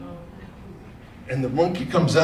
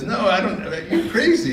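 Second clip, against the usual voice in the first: much higher squeaky scoff; scoffing animal; 9 semitones higher than usual. Acted. Animal mocking the rabbit's boast, high and squeaky. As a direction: brush him off in a squeaky voice.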